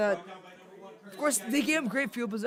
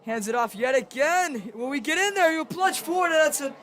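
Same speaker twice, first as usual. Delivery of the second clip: building higher and louder to the score; excited play-by-play; 5 semitones higher, 5 dB louder than usual. Real, not acted. Commentator calling a plunge for the end zone, excited. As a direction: ride the play up toward the touchdown. Live call building to a touchdown.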